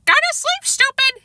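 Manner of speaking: high squawky bird chant; parrot voice. Acted. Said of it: "he chanted".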